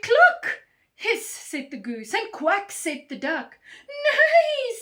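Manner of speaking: high, loud animal cries in story reading; loud animal uproar. A narrator's reading. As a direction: belt out each animal noise. Alarmed animals all cry out.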